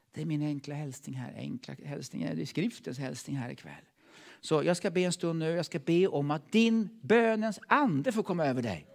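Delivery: ordinary delivery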